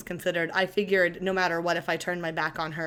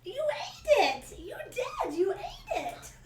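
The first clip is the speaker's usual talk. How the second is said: high, slow, laughing praise for a dog; slower than usual. Real, not acted. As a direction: gush over the dog in a squeaky voice. Praising dog in baby talk.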